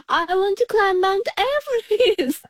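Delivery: high, called-out wish without real volume; mock shout. Climber recalls shouting on the mountain with brother, high mock shout. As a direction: imitate calling across a mountain, pitched well up. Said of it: "shout on the mountain".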